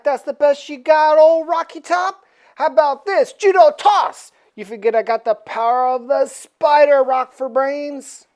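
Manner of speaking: taunting hero. Acted. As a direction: bellow the taunt. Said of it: "He's like".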